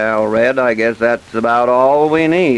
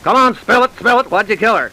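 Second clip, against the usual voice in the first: demanding; 8 semitones higher than usual. Acted. Detective pressing confession.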